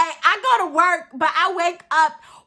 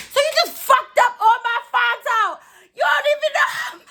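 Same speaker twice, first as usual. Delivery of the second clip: mock angry shout; 11 semitones higher than usual. Telling the guy off for wrecking her Fonto, high shout. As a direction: yell it like he ruined your stuff. Retold anger over ruined Fonto.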